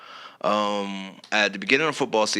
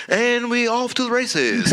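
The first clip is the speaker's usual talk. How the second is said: high, slow announcer call; sportscaster voice; 12 semitones higher, slower than usual. Pretend. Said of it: "That's the sportscaster voice".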